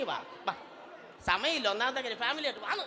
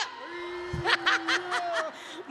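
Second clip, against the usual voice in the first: hammed-up laugh; 6 semitones higher than usual. After the ex-husband joke.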